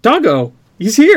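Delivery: high, loud excited greeting. Real, not acted. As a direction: cheer him in with delight. Viewer Doggo arrives in chat.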